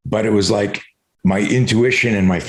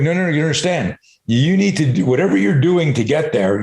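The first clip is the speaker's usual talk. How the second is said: emphatic trainer protest; 7 semitones higher than usual. Retold or imitated. Voicing a trainer correcting a client.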